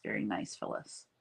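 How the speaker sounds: breathy whispered repeat of the compliment. Real, not acted. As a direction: say it again in a whisper, to yourself. Repeats compliment under her breath.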